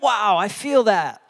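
big, loud, drawn-out wow; enthusiastic reply. Retold or imitated. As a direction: cheer along with a friend, slow and high. Acting out excited text reply.

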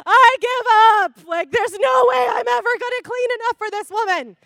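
loud, high comic despair; exasperated shout. Retold or imitated. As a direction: wail in mock defeat at full volume. Acted exasperated outburst in story.

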